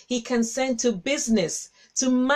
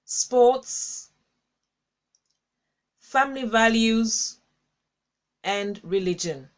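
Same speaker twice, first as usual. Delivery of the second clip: slow list with long pauses between items; deliberate dictation pace; slowly; slower than usual. Shown as a demonstration. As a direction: read each item slowly so viewers can write it down. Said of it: "I'll read them slowly".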